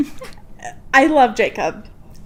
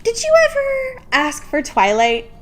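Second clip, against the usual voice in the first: mock squeaky voice; 12 semitones higher than usual. Teasing mock interview question.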